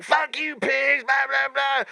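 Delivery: high-pitched mock yell; imitated yelling. Mimicking maced man cursing the cops, high mock yelling. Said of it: "he's yelling at the cops".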